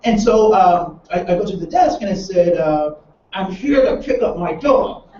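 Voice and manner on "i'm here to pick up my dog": numb, clenched-jaw delivery with a laugh; through gritted teeth. Retold or imitated. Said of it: "I'm gritting my teeth and I'm talking like that".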